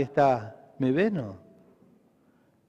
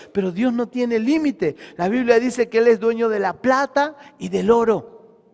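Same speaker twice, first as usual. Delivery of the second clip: raised, emphatic sermon delivery; preaching; 4 semitones higher, 4 dB louder than usual. Real, not acted. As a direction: preach with force, leaning on key words. Preaches to congregation. (prédica enérgica y enfática)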